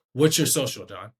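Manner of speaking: assured, projected request; confident ask. Shown as a demonstration. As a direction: ask it firmly, like you expect an answer. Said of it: "I sound confident".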